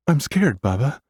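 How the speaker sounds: put-on high, small frightened child voice; little sister confiding fear to big brother; scared little girl. A narrator's reading.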